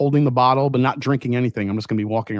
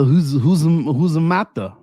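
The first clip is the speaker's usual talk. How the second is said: drawn-out, louder stab at French; mock French accent; 3 semitones higher, 4 dB louder, slower than usual. Shown as a demonstration. Trying to say hazmat in French, fumbled French accent. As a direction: attempt French, badly faked. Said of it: "How do you say hazmat in French?".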